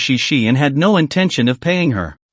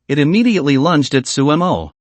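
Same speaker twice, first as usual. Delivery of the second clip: usual speaking voice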